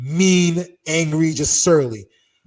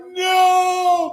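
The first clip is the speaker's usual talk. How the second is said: long, high, loud wail; horrified cry; 13 semitones higher, 6 dB louder than usual. Retold or imitated. Two friends watching the fight start, horrified cry. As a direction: stretch it out in mock horror.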